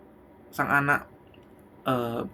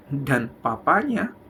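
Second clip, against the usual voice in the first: normal talking voice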